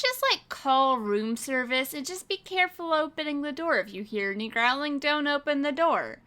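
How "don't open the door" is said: ordinary talk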